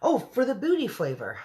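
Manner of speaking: the giant exclaims, lightly voiced; BFG voice. The giant answers the army head, a lightly voiced high exclamation. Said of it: "said the B F G".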